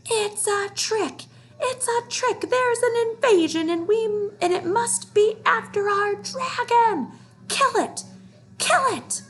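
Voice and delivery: high, loud frantic mob shout; yelling animals. A narrator's reading. Animals yelling to the crowd, high frantic shout. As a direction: shriek the alarm like angry jungle beasts. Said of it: "all yelling".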